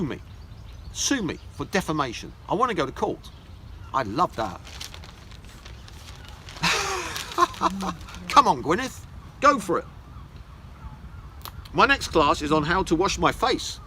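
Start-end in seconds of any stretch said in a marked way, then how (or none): 5.9-8.2 1 big high laugh. Real, not acted. Reacting to his own Goop jibe.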